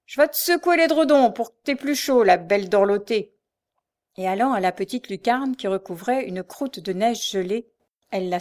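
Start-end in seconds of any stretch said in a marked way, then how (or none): 0.1-3.4 loud, high mocking threat; jeering cry. A narrator's reading. Frochard threatens the sleeping girl, jeering. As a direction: taunt the sleeper loudly. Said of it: "s'écria la Frochard".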